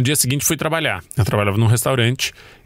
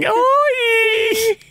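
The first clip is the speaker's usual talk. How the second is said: Teletubby voice. Retold or imitated. Mimicking a Teletubby greeting the haunted cook, squealed very high. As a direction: chirp it out like a cheerful children's TV creature. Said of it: "fica parecendo que é um Teletubby".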